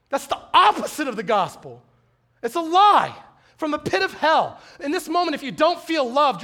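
preacher growing angry at a lie his listeners believe; passionate preaching. Real, not acted. Preaching climax.

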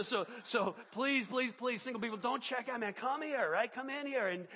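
normal talking voice

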